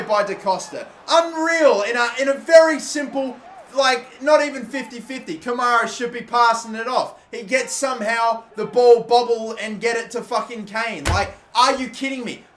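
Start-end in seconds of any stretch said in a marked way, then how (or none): 1.1-12.4 raised, louder complaining; exasperated. Real, not acted. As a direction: vent the disbelief. Complaining about the play.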